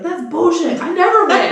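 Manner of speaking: high, loud laughing complaint; brother complaining. Retold or imitated. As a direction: whine it while laughing. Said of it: "he's like".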